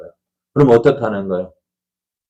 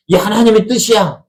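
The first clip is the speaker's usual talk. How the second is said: high, emphatic declaration; stubborn insistence; 9 semitones higher than usual. Acted. Stubborn pastor claiming his wish is God's will, stubborn insistence. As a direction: insist as if no argument is allowed.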